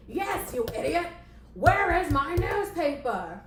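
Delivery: high, loud, furious demand; explode, then drag out the demand slowly; hammed-up tantrum at the assistant; angry boss yell.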